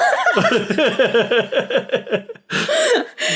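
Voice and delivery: a real laugh. Real, not acted. Laughing at the name.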